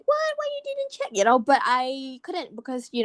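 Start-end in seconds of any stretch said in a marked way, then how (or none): 0.1-1.1 half-hearted scold pitched way up; mock scolding. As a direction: imitate an exasperated complaint without raising the volume. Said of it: "a bit scold her".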